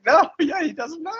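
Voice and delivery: high, squeezed laughing voice; amused disbelief. Retold or imitated. Podcaster delighting in the continuity, high amused disbelief. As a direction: squeak it through a laugh. Amused retelling of Michael's ignorance.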